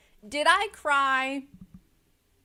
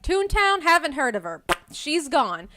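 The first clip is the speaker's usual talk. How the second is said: dismissive; 4 semitones higher, 7 dB louder than usual. Retold or imitated.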